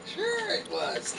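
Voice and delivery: high, drawn-out "sure", falling away softly; skeptical teasing. Real, not acted. Doubting a partner's claim of sarcasm, teasing disbelief. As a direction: stretch it in mock belief.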